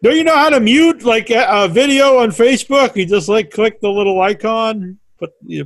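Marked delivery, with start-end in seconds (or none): 0.0-2.9 high, loud exasperated question; mock-angry, raised-voice nagging; playful yelling. Real, not acted. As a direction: badger a clueless guest in jest. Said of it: "I don't wanna yell at you".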